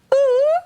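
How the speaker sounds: high, loud hoot for attention; imitated high ooh. Prince trying to get his attention, high hooted ooh. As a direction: falsetto "ooh" from behind. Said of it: "I just heard this noise behind me".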